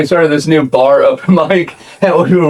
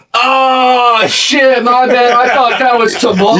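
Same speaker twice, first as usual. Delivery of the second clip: put-on higher, sheepish drunk apology; drunk heckler apology; 9 semitones higher, slower than usual. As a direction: play the drunk realizing his mistake. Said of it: "And he goes".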